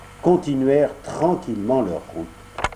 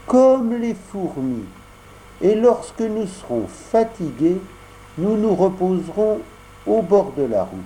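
Pinocchio voice; 6 semitones higher than usual. Acted. Pinocchio encourages weak Geppetto, high and soothing. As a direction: coax his tired papa along gently. Pinocchio comforts his father.

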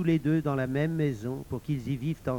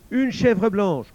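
high, louder offer; woman bargaining; 7 semitones higher, 6 dB louder, slower than usual. Acted. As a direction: raise the bid in a high voice. La femme surenchérit. (femme qui surenchérit, voix aiguë)

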